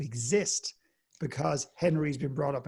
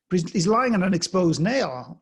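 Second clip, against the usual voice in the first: louder, pressing the point about the nail; insistent visitor; 5 semitones higher, 10 dB louder than usual. Retold or imitated. Visitor insisting to John, louder and pressing. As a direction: push back, a bit exasperated.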